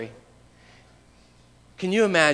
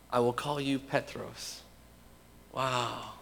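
very soft, low-pitched wonder; hushed awe; 3 semitones lower, 10 dB quieter, slower than usual. Real, not acted. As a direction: lower the voice in quiet awe. Reflects in awe on new name.

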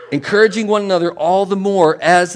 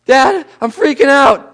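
put-on panicked kid; 8 semitones higher, 9 dB louder, slower than usual. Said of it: "Johnny said to me".

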